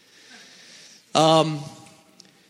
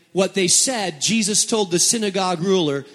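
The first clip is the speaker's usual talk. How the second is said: ordinary delivery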